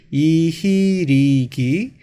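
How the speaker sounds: each suffix stretched out slowly; drawn-out syllables. Shown as a demonstration. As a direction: spell it out syllable by syllable. Teacher spells out passive suffixes. (한 음절씩 길게 늘여서)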